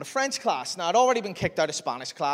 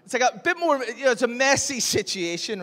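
laughing; 8 semitones higher, 5 dB louder than usual. Real, not acted. Laughing at awkward puberty.

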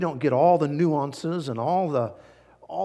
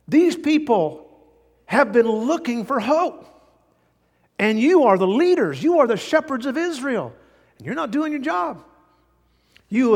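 much higher and louder; impassioned rebuke; 9 semitones higher, 5 dB louder than usual. Acted. Preacher rebukes the leaders of Israel, much higher and louder. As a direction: thunder it at the leaders.

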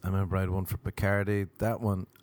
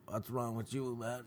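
strained, much softer voice; neck pulled back; 11 dB quieter than usual. Retold or imitated. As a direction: squeeze it out with your neck tensed back. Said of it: "my neck was all back".

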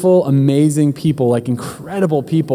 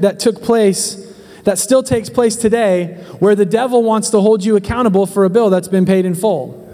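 rising sermon voice, higher and driving; preaching; 4 semitones higher than usual. Acted. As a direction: pastor pressing the congregation toward the point. Preaches the message.

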